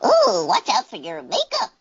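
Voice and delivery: high squeaky chipmunk voice through the teeth. Shown as a demonstration. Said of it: "can I hear chipmunk voice?".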